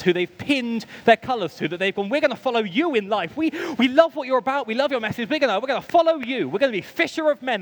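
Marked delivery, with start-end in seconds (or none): 2.1-7.6 high, eager rush of promises; gushing like keen new followers; excited. Acted. Disciples pledging to follow Jesus, excited. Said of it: "That sounds really exciting".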